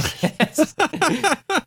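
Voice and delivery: high-pitched giggling; real laugh. Real, not acted. Podcaster cracking up at his own plea, high laugh.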